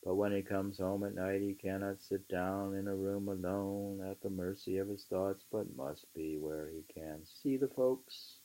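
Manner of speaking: measured, emphatic book reading; reading aloud. Acted. Reading Thoreau aloud to a friend, measured and emphatic. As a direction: read the old essay aloud with weight. Reads on at length.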